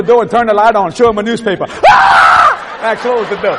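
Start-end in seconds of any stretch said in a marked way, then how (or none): 1.7-2.8 high loud mock scream; startled yell. Waking the dog to frighten it, high mock scream. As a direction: yelp to scare the dog awake.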